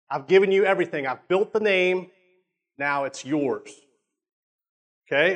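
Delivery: usual speaking voice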